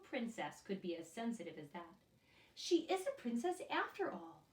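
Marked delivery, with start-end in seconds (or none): 2.6-4.4 a high, light touch of a pleased queen; delighted queen. Acted. Queen telling her son, lightly voiced and high. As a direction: announce it with royal delight. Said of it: "the queen tells her son".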